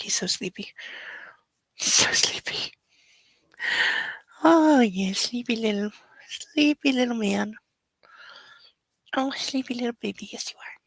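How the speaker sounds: tender owner cooing to her puppy. Real, not acted. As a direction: lull a sleepy puppy back to rest. Baby talk to sleepy puppy.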